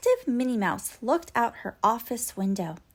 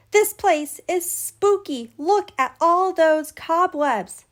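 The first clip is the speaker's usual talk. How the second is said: excited awe; 6 semitones higher, 7 dB louder than usual. Acted. Boys in a spooky room.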